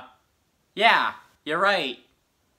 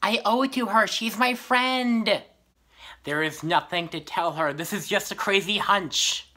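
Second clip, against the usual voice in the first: usual speaking voice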